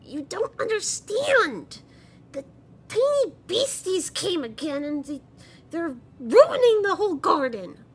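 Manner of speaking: put-on high, loud tantrum of a goblin child; Halamario. Child complains to grandmother about the ruined garden, high yelling. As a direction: yell in a high, strained little voice. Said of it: "and yells at Bellamy".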